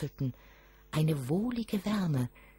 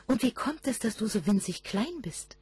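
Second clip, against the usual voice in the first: put-on curious boy; 7 semitones higher than usual. A narrator's reading. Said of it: "wollte der Knabe neugierig wissen".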